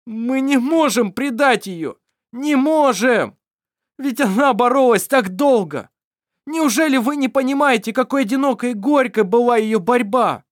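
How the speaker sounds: high, loud desperate pleading; near sobbing. Acted. Daniel almost sobbing, loud and high at Mar. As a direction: cry out in helpless despair. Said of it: "почти рыдал от бессилия".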